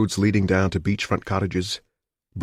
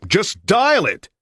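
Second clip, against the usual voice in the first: slow, much higher, furious order; angry order; 5 dB louder, much slower than usual. A narrator's reading. Caller shouts at the operator in slow fury. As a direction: bite off each word, slow.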